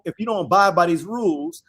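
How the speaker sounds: normal talking voice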